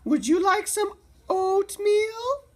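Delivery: a bright high neighbourly call across the yard; calling out as Cherry Sue. A narrator's reading. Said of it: "she called out".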